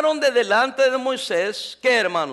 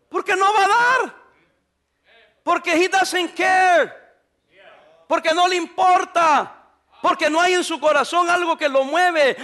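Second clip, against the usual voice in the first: fiery preaching; 4 semitones higher than usual. Acted. Pastor preaching to his congregation about giving, at full force. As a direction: drive the point home to the congregation.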